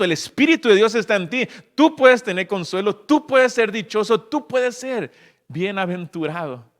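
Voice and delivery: high, loud impassioned sermon; rising evangelical fervor; fervent preaching. Acted. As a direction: preach it with fire to the congregation. Preaching at full force.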